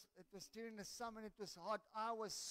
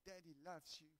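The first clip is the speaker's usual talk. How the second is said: very soft, lowered voice; retold tenderness; 4 semitones lower, 10 dB quieter than usual. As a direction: hush it like a father comforting his child. Retells father's tender words to daughter.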